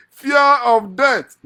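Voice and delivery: long, high, booming cry; loud exclamation. Acted. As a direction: stretch it out as a loud mock-dread cry. Mock dread, exclaiming the phrase.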